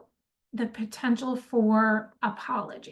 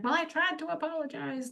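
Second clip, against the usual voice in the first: higher, softer mock protest; defensive excuse; 5 semitones higher, 7 dB quieter than usual. Coach mimics someone whose apology blew up, mock defensive. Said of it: "and then later be like".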